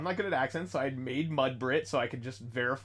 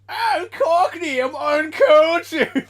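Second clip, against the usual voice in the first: loud, high, drawn-out Cockney caricature; mock Cockney; 13 semitones higher, 12 dB louder, much slower than usual. Quoting a parody to friends, loud high mock Cockney. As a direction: bellow slowly in an exaggerated Cockney accent. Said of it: "What's the one from Hellsinga Bridge?".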